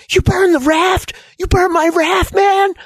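very high-pitched furious yell; screaming accusation. Acted. Michael coming at Jin over the burned raft, screaming accusation. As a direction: scream it as you come at him. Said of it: "coming at him screaming".

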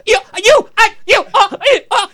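high, loud flustered sputter; sputtering mom. Retold or imitated. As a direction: splutter so angry the words won't come. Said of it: "She was like".

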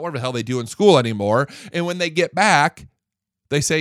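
usual speaking voice

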